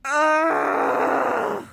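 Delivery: a loud high fangirl shriek; excited scream. Real, not acted. Fan screaming as the trailer drops, shrill squeal. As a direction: let out a thrilled squeal.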